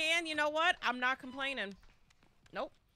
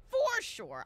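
high-pitched repeat; 12 semitones higher than usual. Real, not acted. Echoing chat in a high squeal. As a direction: pitch jumps way up on "For sure".

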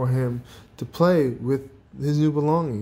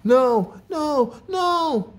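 high, loud cries of alarm; panicked shriek; 13 semitones higher, 3 dB louder, much slower than usual. A narrator's reading. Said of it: "he shrieked".

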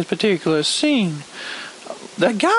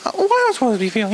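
ordinary talk, nothing marked

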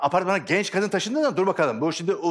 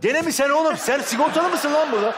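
loud scolding; 10 semitones higher, 4 dB louder than usual. Acted. Scolding a returning improv volunteer.

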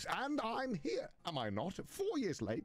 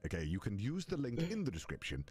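low, polished butler voice; courteous, smooth demon butler; butler character voice; 5 semitones lower than usual. Acted. In-character sponsor read.

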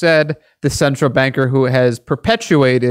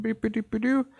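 high computer beeps; robotic beeping; 8 semitones higher, 9 dB quieter than usual. Retold or imitated. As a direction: beep like a machine. Imitating computer noise.

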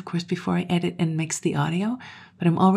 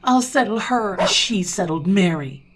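raised, loud vow; shouting; 4 semitones higher, 5 dB louder than usual. A narrator's reading. Owner vows to settle Sally himself, shouting. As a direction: shout it as a threat. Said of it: "I up and shouted".

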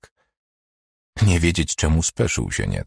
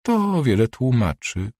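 slow, icy put-down said higher; 6 semitones higher, slower than usual. Acted. Doctor coldly dismisses the embarrassed patient, icy. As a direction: let it land coldly and slowly. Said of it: "skomentowała lodowato".

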